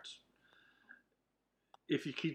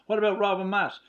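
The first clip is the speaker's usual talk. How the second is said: urgent worry; 8 semitones higher, 8 dB louder than usual. Acted. Urgent worried question.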